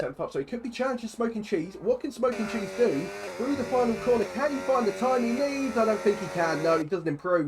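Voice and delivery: urgent motorsport commentary; live race play-by-play. Real, not acted. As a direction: build suspense as the lap unfolds.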